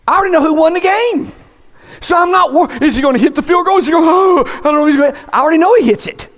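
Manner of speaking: mock suspense while watching a replayed kick; mock game suspense. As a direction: play at nail-biting over a replay. Imitates watching football replay.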